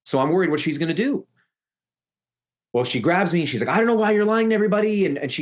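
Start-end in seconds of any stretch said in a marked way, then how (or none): 3.7-5.1 high, hurried imitated accusation; fast, raised-pitch retold confrontation; accusing retold quote. Aunt grabs the teller and accuses them, retold angry accusation.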